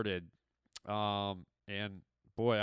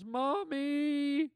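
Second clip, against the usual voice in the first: very high, drawn-out squeal of "Mommy!"; childish cry for mommy; 14 semitones higher than usual. Retold or imitated. Astronaut locked in the isolation chamber wailing, in a high squeal. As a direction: wail it like a scared kid, way up high. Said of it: "and he just goes".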